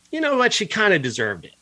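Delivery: mock callous justification. Critic voicing what the director seems to think, mocking. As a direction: lift the pitch, mimicking someone excusing cruelty.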